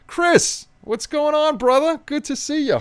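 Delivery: high, bright hello to a friend; excited greeting. Real, not acted. Greeting a viewer warmly.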